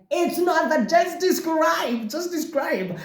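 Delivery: urging friends. Retold or imitated. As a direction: push them to just describe. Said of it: "And I'm like".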